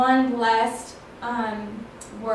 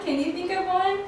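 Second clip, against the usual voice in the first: ordinary delivery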